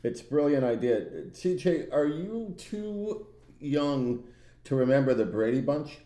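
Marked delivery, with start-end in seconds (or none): none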